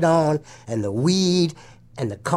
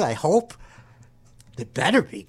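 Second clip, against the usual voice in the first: normal talking voice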